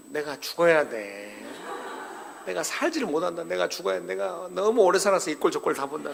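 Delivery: a hint of an old churchgoer wailing he has lived too long; old elder's lament. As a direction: sigh it out like a grumbling old elder. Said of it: "어르신 여러분, 죄송합니다".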